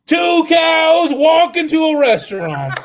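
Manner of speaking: laughing shout. Acted. Comic restarting the joke again, laughing shout. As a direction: bellow the opener, cracking up.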